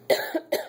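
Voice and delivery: real dry cough; coughing fit. Real, not acted. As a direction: coughs once, harshly. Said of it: "I'm sorry I'm coughing so much".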